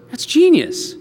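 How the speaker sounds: high-pitched delighted verdict; impressed exclamation. Acted. Pharaoh hearing Joseph's plan, impressed exclamation.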